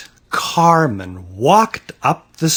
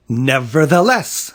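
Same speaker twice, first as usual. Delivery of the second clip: usual speaking voice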